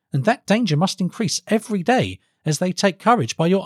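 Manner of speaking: high-pitched, earnest counsellor to a king; formal, high young strategist's plea; earnest advisor. Acted. Felix pleads with the king.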